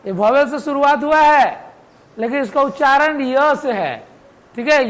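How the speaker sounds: normal talking voice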